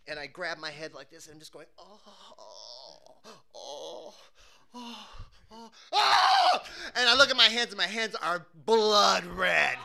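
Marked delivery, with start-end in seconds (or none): 1.8-6.6 acted groans of pain, rising to a shriek; pained groans building. Acts out pain holding head.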